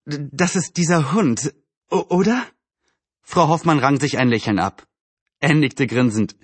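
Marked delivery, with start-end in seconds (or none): 0.1-2.4 nervous, halting question; hesitant stammer. Acted. Neighbour uneasy about the big dog, nervously stammering. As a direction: stumble uneasily over the first sounds.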